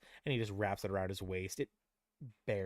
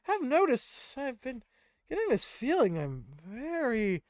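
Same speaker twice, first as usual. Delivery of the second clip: high, slow, sleepy voice; drowsy brother; 13 semitones higher, 13 dB louder, slower than usual. Acted. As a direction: drift off mid-sentence, much higher. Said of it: "And he's like".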